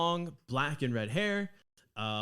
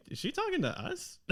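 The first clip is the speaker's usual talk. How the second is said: a much higher, puzzled Australian-free question; bewildered Caleb. Acted. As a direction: squeak in disbelief. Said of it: "Caleb goes".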